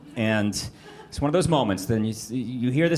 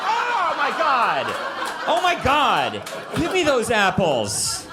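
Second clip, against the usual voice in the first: laughing, higher, slow exaggerated outrage; mock outraged; 12 semitones higher, slower than usual. Dad laughing as he demands the apples, hammed-up outrage. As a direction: ham up giving in, laughing.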